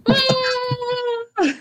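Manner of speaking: long high wail of a falling victim; high sustained mock scream; falling cry. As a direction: hold a high cry, then drop. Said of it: "you are shoved overboard and you hear".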